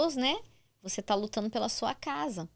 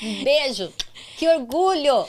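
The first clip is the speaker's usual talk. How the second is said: excited praise; 4 semitones higher, 6 dB louder, slower than usual. Real, not acted. Host cheering the mother.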